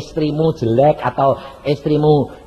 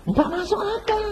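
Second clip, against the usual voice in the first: much higher, mocking skeptic's answer; 7 dB quieter than usual. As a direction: sing it up high like a scoffer.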